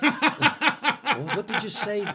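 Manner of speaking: high, breaking with laughter; laughing. Real, not acted. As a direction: talk through a fit of laughs. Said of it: "Don't make me laugh".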